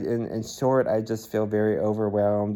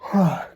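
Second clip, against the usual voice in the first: loud weary sigh; 9 semitones higher, 6 dB louder than usual. Real, not acted. Tired vlog, loud sigh.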